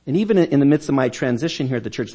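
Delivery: ordinary talk, nothing marked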